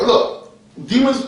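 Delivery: normal talking voice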